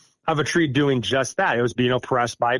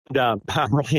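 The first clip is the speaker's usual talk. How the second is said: ordinary talk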